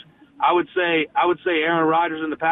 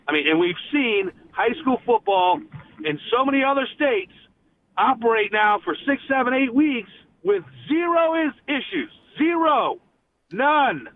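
higher-pitched, exasperated insistence; passionate frustration; 7 semitones higher than usual. Real, not acted. Guest criticizing the ban on high school football, passionately frustrated. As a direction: drive home "Zero" and "None" as separate, emphatic points. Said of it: "Love the passion".

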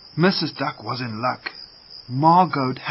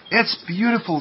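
higher-pitched, marvelling exclamation; wonder; 7 semitones higher than usual. A narrator's reading. Derek seeing his first rainbow, in wonder. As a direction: breathe out delight at the sight. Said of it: "he looked around in wonder".

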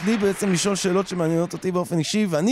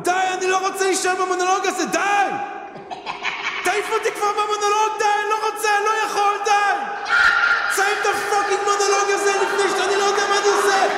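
mock meltdown shout; 12 semitones higher, 3 dB louder than usual. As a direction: rant like you're losing it, for laughs. Mock meltdown yelling at sound guy.

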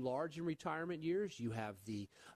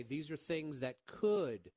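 could said slowly and louder, leaning hard on could; slow down and punch the word could as a warning; stress on could; 2 semitones higher, 4 dB louder, slower than usual. Real, not acted. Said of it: "I emphasize the word could".

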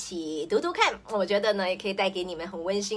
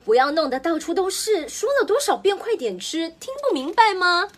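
higher, louder, fed-up nagging; exasperated scolding; 8 semitones higher, 6 dB louder than usual. Acted. Mother scolding child making a mess at dinner, loud and exasperated. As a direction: scold like you've said it a hundred times.

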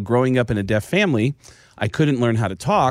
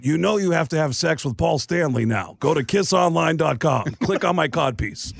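a lightly done rock star voice, a bit higher; 6 semitones higher than usual. Retold or imitated. Prank caller in character tells the singer something absurd, lightly put-on voice. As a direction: sell the absurd line in a hint of rock star. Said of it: "your [name] impersonation".

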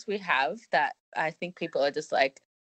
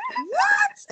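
squeaky high baffled "What?", far higher; mock shock, pitched way up. Friends reacting to slang, high squeaky disbelief. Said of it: "they're like".

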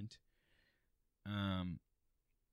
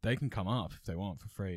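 normal talking voice

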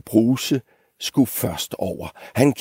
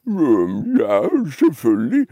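hesitant grumble, swooping up in pitch; grumbling big goat; 6 semitones higher than usual. Acted. Big goat pretending to be brave, hesitant grumbly voice. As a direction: hedge it as a blustering big goat. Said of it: "brummede den store bukke Bruse".

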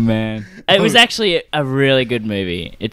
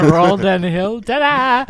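playful ta-da; 7 semitones higher than usual. Pretend. Joking mock origin story.

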